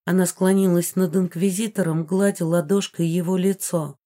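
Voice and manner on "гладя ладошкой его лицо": plain reading voice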